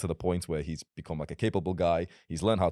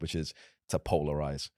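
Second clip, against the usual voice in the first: ordinary delivery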